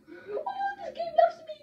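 very high, loud squeal of delight; gleeful high-pitched outburst; overjoyed squeal. Pretend. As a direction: squeal it out, thrilled by the game.

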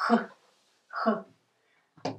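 harsh throaty Russian h with a brief vowel; h sound. Shown as a demonstration. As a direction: say a lone h as rough friction at the back. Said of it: "Sound h".

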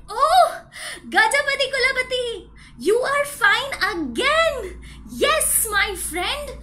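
high, loud joyful exclaiming; very happy. Acted. Banana seller finds his elephant friend well, overjoyed. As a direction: greet a friend with big delighted relief. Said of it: "He was very happy for him".

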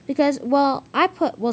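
high, loud false starts; flustered excuse. Acted. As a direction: stumble into a defensive explanation. Gordon fumbles for an excuse.

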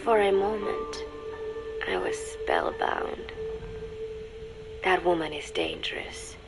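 breathy, whispery narration with long pauses; hushed, spellbound. A narrator's reading. Woman recalling being rubbed with lotion, hushed and spellbound. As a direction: drift softly, as if in a trance. Spellbound recollection of the intimate moment.

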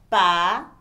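"papel" broken into loud "pa", long pause, "pel"; syllable by syllable. Shown as a demonstration. Teacher showing the two sounds of a word, split syllables. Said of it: "você juntou dois conjuntos de som".

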